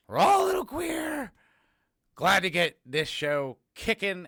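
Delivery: high, loud playful holler; big high-pitched cry; exuberant shout. Pretend. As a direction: belt it out as a silly announcement. Exuberant outburst during show intro.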